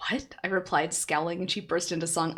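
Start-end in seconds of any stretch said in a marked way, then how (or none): none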